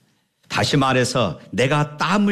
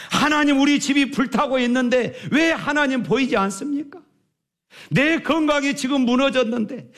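high, pleading lament; a suffering believer wailing at heaven; anguished cry to God; 12 semitones higher than usual. Acted.

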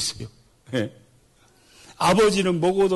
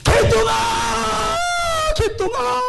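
high, stretched crying shout; desperate calling out; much slower than usual. Acted. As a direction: call a lost child with tears. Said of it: "소리를 질러요". (잃어버린 아들을 울며 부르는 높은 외침)

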